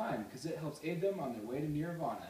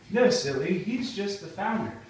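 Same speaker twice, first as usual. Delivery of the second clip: loud; 3 semitones higher, 8 dB louder than usual. Acted. Host corrects kid.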